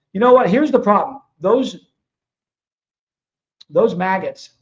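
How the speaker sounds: loud angry outburst. Real, not acted. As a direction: spit it out in anger. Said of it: "a little pissed off".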